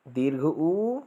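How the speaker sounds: ऊ held long for two beats; long u vowel. Shown as a demonstration. Teacher demonstrating the long vowel, held two beats. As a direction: stretch the ऊ in दीर्घ ऊ to two beats. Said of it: "तीन प्रकार के ऊ".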